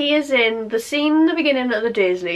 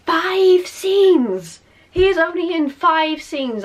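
loud, high outburst of disbelief; exasperated shout; 6 semitones higher, 3 dB louder, slower than usual. Real, not acted. Vlogger ranting about Dobby's few scenes, exasperated shout. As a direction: throw the words out in exasperation.

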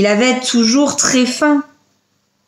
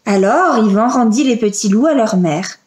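ordinary delivery, nothing marked

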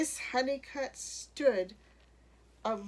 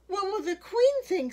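high, loud cry of alarm; much higher, loud fretting voice; dismayed cry; 11 semitones higher, 9 dB louder than usual. A narrator's reading. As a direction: call out in alarm from the crowd. Dismayed crowd calls out.

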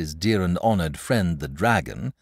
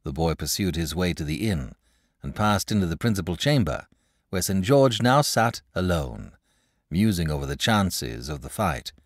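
ordinary delivery

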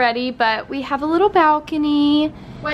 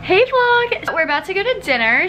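ordinary talk, nothing marked

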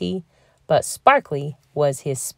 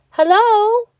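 high, loud calling hello; shouted; 6 dB louder than usual. A narrator's reading. Lonely bear cub calling to sleeping friends, shouting. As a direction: call out across the woods. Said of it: "he shouted".

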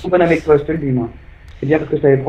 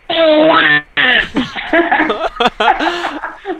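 squeaky gremlin giggle; 11 semitones higher than usual. Real, not acted. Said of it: "Bully avec la voix de canard".

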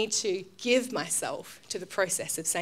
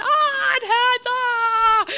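high, loud hammed-up wail of a little boy; mock child's wail; 9 dB louder, much slower than usual. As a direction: play a kid screaming that it hurts. Son screaming at toenail cutting.

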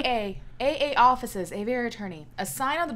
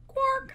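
bird squawk; 11 semitones higher than usual. Acted. Jokes a bird guard's squawk.

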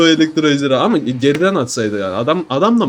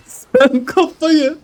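kafayı stretched very slow and high; high drawn-out teasing; 10 semitones higher, much slower than usual. Acted. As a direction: sing kafayı high and long like a tease. Teasing the opponent. (kafayı kelimesini çok ince ve uzun söyle)

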